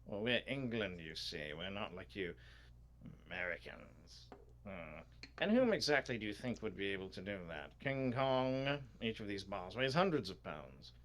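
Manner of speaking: dismissive English curator, lively swings in pitch; British museum official. Acted. Curator answers worry about stolen gold, dismissive British voice. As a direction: scoff politely as a British official.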